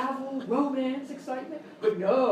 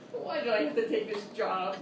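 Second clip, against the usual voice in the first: ordinary delivery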